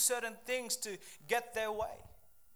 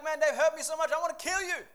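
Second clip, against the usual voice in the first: high, loud mock fury; hammed-up vengeful cry; angry outburst; 6 semitones higher, 7 dB louder, faster than usual. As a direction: act out someone hurt and lashing out. Acts out a hurt, vengeful person.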